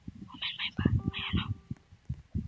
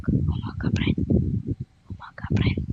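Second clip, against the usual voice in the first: whisper. Acted. Sneaking greeting.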